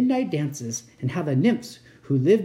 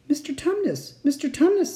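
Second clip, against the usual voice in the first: much higher, pleading call; great distress; 11 semitones higher than usual. A narrator's reading. Lucy calling to the weeping fawn, distressed. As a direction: call out in high-pitched distress. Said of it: "said Lucy in great distress".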